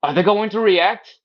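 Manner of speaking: high anxious fretting voice; worried onlooker. Retold or imitated. Onlookers nervously watching a kid get a shot, high worried fretting. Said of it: "everybody else is like".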